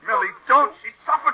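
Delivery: very high loud panicked cry; frantic plea. Acted. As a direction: beg her to stop in horror. Gerald begs her to stop.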